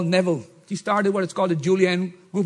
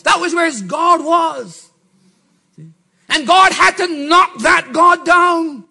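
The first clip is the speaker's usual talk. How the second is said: emphatic shout; 8 semitones higher, 6 dB louder, slower than usual. Real, not acted. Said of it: "preaching emphasis".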